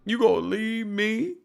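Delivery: high, whiny pleading; mock crying Kandi. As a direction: whine like she's about to cry. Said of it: "then she starts crying".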